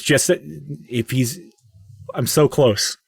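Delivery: slow and broken up, mildly overcome; a little emotional. Acted. Father begs to go a bit further, a little emotional. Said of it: "getting a little emotional".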